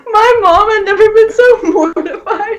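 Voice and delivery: high, squealing through real laughter; laughing hard. Real, not acted. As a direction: say it while helplessly cracking up. Cracking up over embarrassing story.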